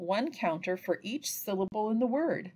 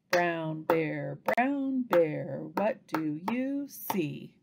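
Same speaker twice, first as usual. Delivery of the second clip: slow, measured chant of separate words; word-by-word tapping; 3 semitones lower, much slower than usual. Shown as a demonstration. Teacher taps out a book phrase, word by word. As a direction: give every word its own slow beat. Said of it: "tap out the words in a sentence".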